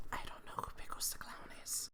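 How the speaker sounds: hushed conspiratorial whisper; whispered aside. Real, not acted. Vlogger confiding a joke to viewers, whispered.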